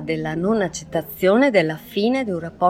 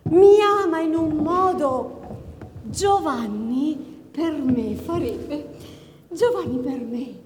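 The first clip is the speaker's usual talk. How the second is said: obsessive lovesick woman; 10 semitones higher, 3 dB quieter than usual. Acted. Obsessive lover's monologue.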